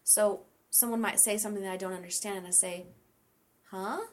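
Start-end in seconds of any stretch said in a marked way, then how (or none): 3.7-4.0 "Huh?" with an upward inquisitive lift; a questioning "Huh?" going up; rising huh. Shown as a demonstration. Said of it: "rising tones without words".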